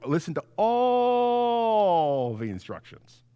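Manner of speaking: "listen to all the instructions" very slow and drawn out; stretched emphasis. Acted. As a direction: land the lesson by stretching "all". Preacher closes on key phrase.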